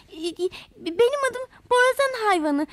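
frightened little creature stammering. A narrator's reading. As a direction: stutter out the name in fright. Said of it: "diye kekelemiş".

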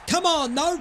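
ordinary talk

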